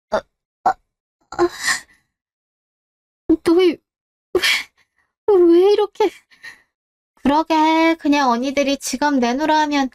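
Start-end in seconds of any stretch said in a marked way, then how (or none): 0.1-1.5 broken, tearful stammer; whimpering. Acted. Beaten boy whimpering in pain. 3.4-6.1 frightened, hurt protest; pained cry. Acted. Victim protests through the beating.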